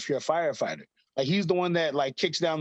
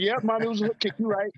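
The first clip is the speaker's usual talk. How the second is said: higher-pitched, humoring agreement; placating agreement; 6 semitones higher, faster than usual. Retold or imitated. Son humors mom about the kick's name, placating. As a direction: give in to keep the peace, pitched up.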